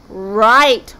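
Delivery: loud, drawn-out roared word; monster roar. Acted. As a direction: roar it like a sea monster about to eat. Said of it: "roared the Kraken".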